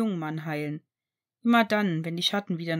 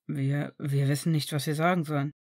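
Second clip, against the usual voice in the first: stammering; 6 semitones lower than usual. Acted. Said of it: "stotterte er etwas unbeholfen".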